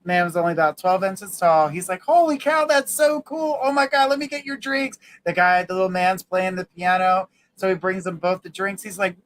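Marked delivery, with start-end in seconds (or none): 2.0-5.0 high, excited gush; amazed bartender. Acted. Bartender amazed at the tiny piano player, excited. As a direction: gush in raised-pitch astonishment.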